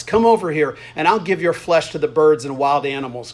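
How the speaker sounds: ordinary talk, nothing marked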